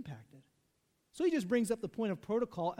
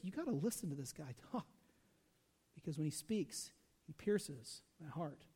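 awed sincerity; 4 semitones lower, 7 dB quieter than usual. Retold or imitated. Officers telling leaders how Jesus moved them, soft and awed. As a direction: let it sound heartfelt and hushed.

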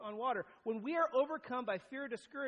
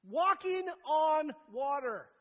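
slow and loud, every word weighted; dramatic emphasis; 7 semitones higher, 10 dB louder, slower than usual. Acted. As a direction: land the miracle slowly and loudly. Dramatic reveal of Jesus.

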